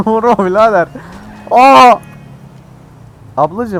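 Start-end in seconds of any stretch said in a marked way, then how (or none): 1.5-1.9 loud high startled yell; startled shout. Real, not acted. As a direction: yell out in shock. Sudden crash in game.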